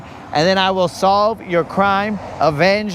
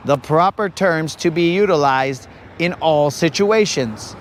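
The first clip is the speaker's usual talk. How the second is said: usual speaking voice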